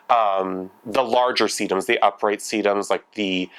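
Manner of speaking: ordinary talk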